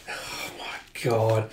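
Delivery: slow breathy whisper of disbelief; whispered aside. Real, not acted. Reacting to the story, whispered in disbelief. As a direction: barely voice it, stunned.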